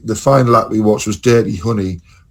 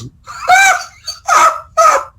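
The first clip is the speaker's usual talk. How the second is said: real high-pitched cackle; high burst of laughter; 4 dB louder than usual. Real, not acted. Telling friend the singer's from Sheffield, high laughing burst.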